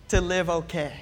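usual speaking voice

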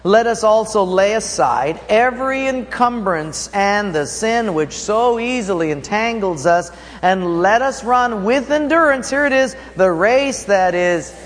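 reading aloud with the congregation. Real, not acted. Pastor leads church in reading Hebrews, loud and high. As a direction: lift it up and read it out together. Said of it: "Read that with me. Go".

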